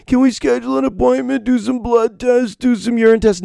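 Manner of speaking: high, fast whiny pleading. Retold or imitated. Sick patient begging his doctor for tests, high whine. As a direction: beg the doctor in a squeaky whine.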